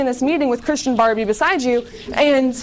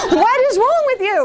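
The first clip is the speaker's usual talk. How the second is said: mock exasperation; 13 semitones higher, 3 dB louder than usual.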